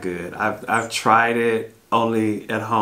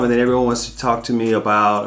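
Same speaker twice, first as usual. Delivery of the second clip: ordinary talk, nothing marked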